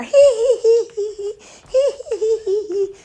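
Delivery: high giggling hee hee; woman's flirty giggle imitated. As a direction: mimic a flirty giggle overheard on the phone. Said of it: "I hear her".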